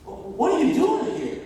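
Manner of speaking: loud, high-pitched disbelief; hammed-up baffled questioning; acted incredulity. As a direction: demand answers as if astonished. Acts out a startled reaction.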